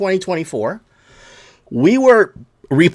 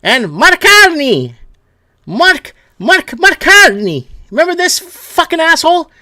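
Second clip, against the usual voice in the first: drawn-out, loud, higher call of the name; mocking shout; 9 semitones higher, 6 dB louder, slower than usual. Real, not acted. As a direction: bellow the name slowly like summoning him. Calling out the prime minister.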